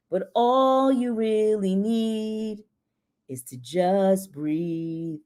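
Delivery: slow, drawn-out, soothing recitation of song words; soft lyric reading. Shown as a demonstration. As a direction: breathe the lyrics out slowly, like a lullaby. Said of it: "I just wanna read a little bit of the words".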